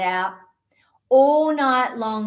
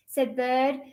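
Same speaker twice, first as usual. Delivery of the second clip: ordinary delivery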